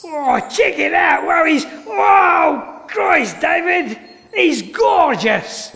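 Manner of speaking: a high, slow, thrilled Aussie shout; excited Australian presenter. Retold or imitated. Presenter shouting to David about the gorgeous creature, in a thrilled Australian accent. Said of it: "an Australian or a South African".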